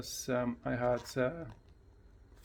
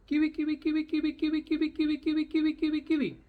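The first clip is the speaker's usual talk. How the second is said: very high, loud rapid chant; high, loud, bouncing Kiwi kiwi; excited high Kiwi; 14 semitones higher, 6 dB louder, much faster than usual. Shown as a demonstration. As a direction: squeal the name over and over. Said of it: "Did you see the difference?".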